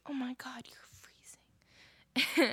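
slow, drawn-out whisper; hushed, over-dramatic worry; whispered alarm. Retold or imitated. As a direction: stretch the whisper out in mock shock. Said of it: "She's like".

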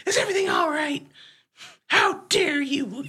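hammed-up offended accusation; mock indignation. Retold rant continues.

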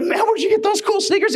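buddy at school admiring sneakers; teen buddy. Retold or imitated. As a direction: sound impressed like a schoolkid. Teen buddy asks about sneakers.

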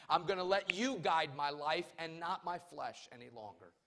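loud, high-pitched pleading prayer from the pulpit; preached prayer. Acted. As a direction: cry out to the Lord in repentance, preacher-style. Voices a repentance prayer from pulpit.

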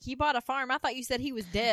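loudly bewildered, said for laughs; imitating a loud, baffled old lady; mock loud. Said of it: "Really loud".

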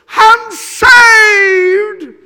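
huge high-pitched holler, held long; exultant shout. Retold or imitated. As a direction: belt it out as an imitated convert's cry. Acts new convert's cry of joy.

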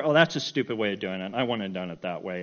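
low-pitched, nitpicking grumble; mock criticism. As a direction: find fault like a self-appointed judge. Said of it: "criticize every".

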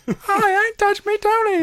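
very high squeaky teddy bear voice; high cute toy bear voice; soft gentle teddy bear. Pretend. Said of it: "I wanna do teddy bear voice".

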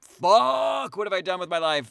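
loud, high outburst of regret; mock anguished cry. Gamer realising it's four in the morning, mock anguished cry. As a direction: cry out as the player who lost all night. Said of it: "and you're like".

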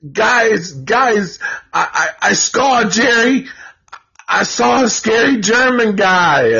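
put-on high, breathless little-girl voice; scared little girl. Phoebe running back to the boys, high and frightened. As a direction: blurt it out like a frightened kid. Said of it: "exactly what a little girl would".

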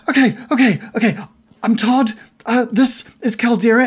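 bubbling over but reining it in; barely contained excitement. Acted. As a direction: try to hold in your glee while introducing yourself. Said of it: "he's trying to contain, contain it".

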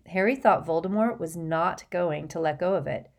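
ordinary delivery

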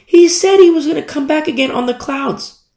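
high, insistent angel voice; angel's voice. Retold or imitated. Angels tell disciples he will return, high angel voice. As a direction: pitch it up like an exasperated angel. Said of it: "the angels are like".

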